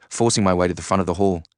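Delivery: ordinary delivery, nothing marked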